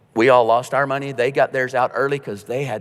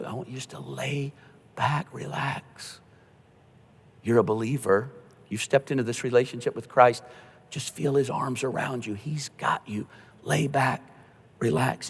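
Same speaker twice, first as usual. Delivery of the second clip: soothing the dying; 3 dB quieter than usual. Retold or imitated. Pastor comforting a dying believer, soft and gentle. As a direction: murmur calm reassurance to someone frightened. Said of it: "tell them, you know".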